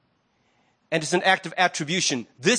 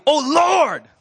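mock shout; 8 semitones higher, 6 dB louder than usual. Shown as a demonstration. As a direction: Lord called out loud and high. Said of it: "like it's shouting".

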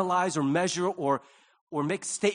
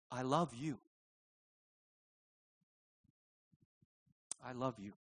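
tender; 6 semitones lower, 11 dB quieter than usual. Pretend. Sincere prayer to God, whispered close.

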